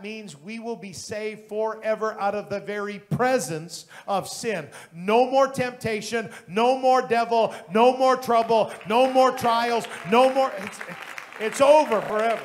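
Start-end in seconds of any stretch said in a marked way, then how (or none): 4.9-12.4 loud, slow, rising preacher's cry; preaching at full force. Acted. Pastor proclaiming glorification to the church, loud preaching. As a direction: build the crowd to a shout.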